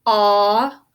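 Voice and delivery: loud held "Ah"; single sound. Shown as a demonstration. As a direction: sound out "Ah" as one long open vowel. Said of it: "what do you hear?".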